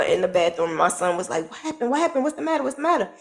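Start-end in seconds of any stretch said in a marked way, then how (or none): 1.5-3.1 worried son. Retold or imitated. Son's worried questions acted out.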